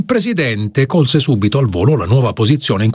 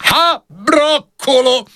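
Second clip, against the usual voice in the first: much higher, slow scornful call; old peasant shouting; 14 semitones higher, much slower than usual. Acted. Old farmer jeers at Tararà, high mock shout. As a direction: jeer from the back of the room. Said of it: "gridò un vecchio contadino".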